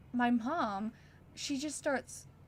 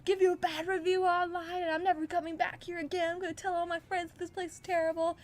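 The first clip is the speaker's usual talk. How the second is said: much higher, outraged mock screech; imitating a screaming mom; 7 semitones higher than usual. Mom yelling at the manager, hammed-up outraged higher screech. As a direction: ham up the furious customer. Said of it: "she screamed at the fucking manager".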